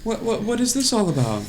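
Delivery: high, airy Barbie voice. Acted. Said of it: "Barbie, she goes".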